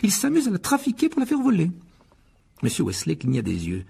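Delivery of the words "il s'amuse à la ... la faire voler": high-pitched, mounting accusation; indignant wife. Acted. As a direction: scold the husband in a high voice. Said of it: "les yeux flamboyants". (épouse indignée, voix aiguë)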